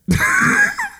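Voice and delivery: squealing burst of laughter; high shrieking laugh. Real, not acted. Breaks up at punchline.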